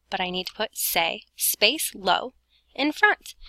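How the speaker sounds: ordinary delivery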